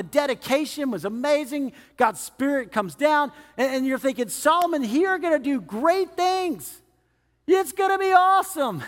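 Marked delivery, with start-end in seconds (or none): 4.3-8.8 mock awe. Imagined hopeful onlooker.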